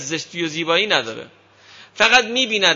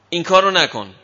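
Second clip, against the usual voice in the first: angry order; 5 dB louder than usual. Retold or imitated.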